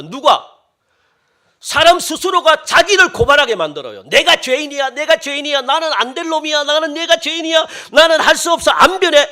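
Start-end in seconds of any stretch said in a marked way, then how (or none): 4.0-9.3 louder, higher self-condemning wail; mock a sinner beating himself down; despairing sinner. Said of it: "이렇게 만듭니다". (자책하는 죄인 흉내)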